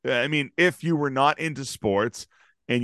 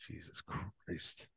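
slow, soft, whispered exasperation; weary groan; 6 semitones lower, 24 dB quieter, slower than usual. Retold or imitated. His inner voice on waking, a slow soft groan. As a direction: breathe it out tired and defeated. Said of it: "person in my head going".

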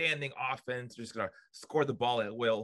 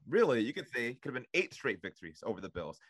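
usual speaking voice

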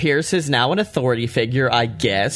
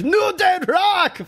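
much higher, slow cheering shout; imitated Asuka shout; slower than usual. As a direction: cheer it out like a fired-up wrestler. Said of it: "And then Asuka's like".

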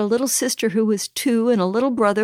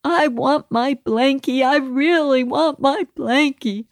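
put-on higher, whiny little-boy wail; crying toddler; 4 semitones higher than usual. Mother retelling her son starting up crying again, higher whiny child voice. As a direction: plead like a small child starting to cry again. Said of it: "then he'd start up again".

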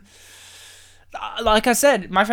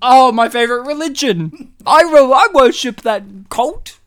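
loud, raised, hammed-up delight; mock fan gushing; 4 semitones higher, 9 dB louder than usual. Co-host hails his favourite religion, mock fan gushing. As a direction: gush loudly as a devoted worshipper. Gushing again over another mistranslated title.

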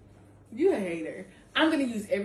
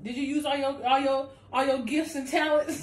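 God challenging; 5 semitones higher, 4 dB louder than usual. Retold or imitated. God voiced confronting listener.

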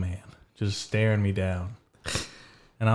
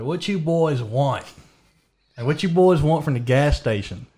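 put-on country accent; 6 semitones higher than usual. Man asks friends in the car at gas station, country accent. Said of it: "talking in my country accent".

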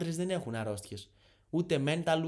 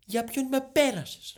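high, loud, slow outrage; offended indignation; 9 semitones higher, 6 dB louder, slower than usual. Acted. Viewer insulted by a life coach's offer, offended indignation. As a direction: draw out the offended question, pitched up.

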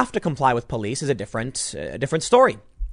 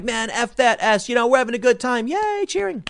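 mock partying rocker; 8 semitones higher than usual. Commentator mocks the singer cheering with the crowd, high goofy party voice. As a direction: ham up a careless rock singer whooping at a crowd. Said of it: "and he's like".

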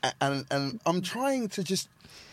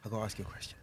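very soft, low retold aside; hushed confiding; 8 semitones lower, 16 dB quieter, much faster than usual. Nervous host approaching a colleague after the show, hushed and low. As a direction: drop right down as if confiding.

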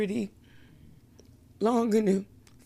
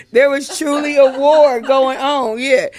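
ordinary delivery

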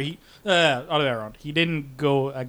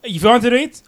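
mock exasperation; 9 semitones higher, 10 dB louder than usual. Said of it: "He's like".

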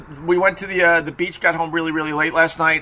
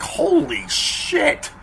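drawn-out, much higher gasp of shock; shocked holy shit; 12 semitones higher, much slower than usual. Retold or imitated. Retelling meeting the snarling dog, stunned slow high exclaim. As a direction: stretch it slowly, high and stunned. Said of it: "And I'm like".

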